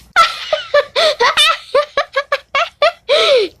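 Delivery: high, rattling laughing fit; uncontrollable laughing. Acted. Friend can't stop at the weird hiccups, uncontrollable laughing. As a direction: burst out in a long high cackle. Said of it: "I can't stop laughing".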